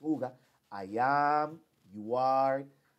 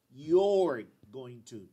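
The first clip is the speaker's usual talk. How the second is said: slow, "you're" run together and stressed; slow contracted form; 8 dB louder, much slower than usual. Shown as a demonstration. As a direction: glide into "you're" slowly. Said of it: "Aquí yo digo".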